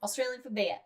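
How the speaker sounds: mock Aussie ad slogan; Australian accent. Hosts recalling the Foster's slogan, mock Australian.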